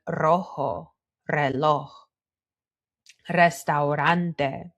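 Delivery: "rojo, reloj, restaurante" starting on a trill; "rojo, reloj, restaurante" in careful Spanish, each opening R trilled; rolled initial R. Shown as a demonstration. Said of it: "R is rolled is when we have an R at the beginning".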